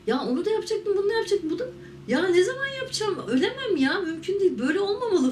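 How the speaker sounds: high, urgent, pleading to herself; desperate disbelief, pitched high and pressed; panicked inner voice. Retold or imitated. Thinking on the pavement, bleeding: high panicked pleading. Panicked thoughts lying stabbed.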